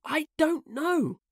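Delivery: put-on slow, very high boyish wonder; awestruck. A narrator's reading. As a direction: draw it out in hushless awe. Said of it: "Nibs said, awestruck".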